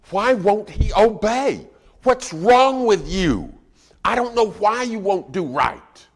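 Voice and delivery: high, loud, slow exasperated shouting; frustrated parent yelling. Acted. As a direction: berate a child at full voice, drawing the words out. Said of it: "Is parenting sometimes frustrating".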